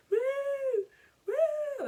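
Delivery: very high hooted yell; imitated wail for someone inside; high-pitched mock holler. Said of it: "yelling our brother's name".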